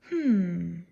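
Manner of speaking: low considering hmm; thoughtful hum. Acted. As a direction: hum as if studying a picture. Teacher studies the paper.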